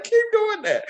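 high, loud mock-excited voice; squeaky eager encouragement; imitating wife. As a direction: play his wife urging him on. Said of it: "she was like".

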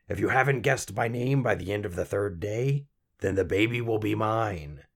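creaky imp laying down terms; croaky little man, ominous. A narrator's reading. Little man threatening to take baby, ominous.

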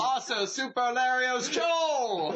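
high, drawn-out hype intro; mock announcer shout. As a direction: belt it out like a game show intro. Jokey self-introduction as co-host.

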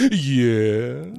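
drawn-out catchphrase yeah; comedian's long rising catchphrase; Jack Benny. Retold or imitated. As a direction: stretch it out, tipping up at the end. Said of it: "I believe his catchphrase".

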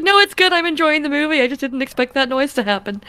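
high, amused reassuring voice; amused reassurance. Retold or imitated. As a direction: insist it's fine, laughing inside. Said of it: "And I was like".

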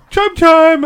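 high chiming imitation; phone chime. Imitating the group chat phone chime, high chime.

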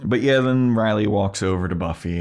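ordinary talk, nothing marked

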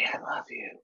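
voiceless, hushed affection; creepy whisper. Acted. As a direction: whisper it like a secret admirer. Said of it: "That sounds creepier".

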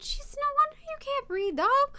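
very high-pitched exclamation to a dog; high voice, talking to a pet; mock exclamation. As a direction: squeal it in mock dismay at the dog. Voiced reaction to dog's breathing.

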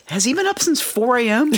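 high, amazed question; mock amazement. Guest amazed at the huge breakfast spread. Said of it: "I was just like".

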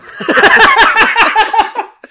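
high, loud, helpless laugh; loud laughing fit. Real, not acted. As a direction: break down laughing at the line. Laughs at "Hey, come on.

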